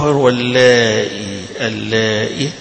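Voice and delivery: اللائي said al-lā'i with a clear hamza and a long ā; glottal stop reading. Shown as a demonstration. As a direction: hold the ā of اللائي, then a crisp hamza. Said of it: "اللي هيحذفوا الياء فيها هيحققها".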